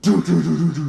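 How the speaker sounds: loud rapid knocking noise; loud staccato pounding sound; imitated pounding. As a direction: rattle off vocal door-banging. Imitates banging before stomping off.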